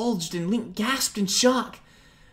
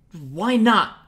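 louder, sharp demand; anger rising; 3 semitones higher, 7 dB louder than usual. Acted. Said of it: "Link demanded".

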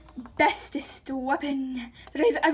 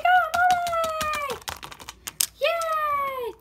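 put-on much higher, slow child cheer; little daughter cheering; 13 semitones higher, much slower than usual. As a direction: cheer like an excited little girl.

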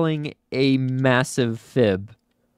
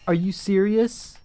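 stunned drawn-out disbelief; 6 semitones higher, much slower than usual. Real, not acted. Disbelief at failure.

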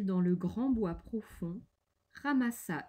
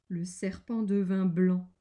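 plain reading voice

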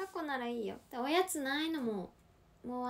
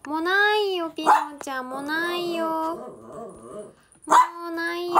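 loud, firm no to the dog, much louder; firm scolding; 4 semitones higher, 8 dB louder than usual. Real, not acted. 犬にもうおやつはないと言い聞かせる、大きな声で叱る. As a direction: tell the begging dog there's nothing left.

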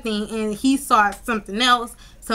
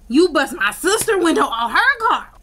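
higher-pitched outraged accusation; 6 semitones higher, 4 dB louder than usual. Retold or imitated. Telling off the ex over sister's window, outraged. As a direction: confront him, pitch jumping up.

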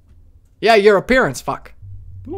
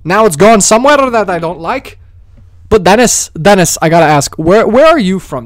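loud excited banter; 3 semitones higher, 8 dB louder than usual. Real, not acted. Streamer loudly jokes with viewer Dennis about his accent. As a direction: keep the energy up and loud.

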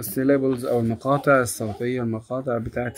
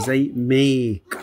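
slow demo; much slower than usual. Shown as a demonstration. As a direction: make said very slowly with a long vowel. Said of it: "بيجي بعد ال A". (نطق make ببطء)

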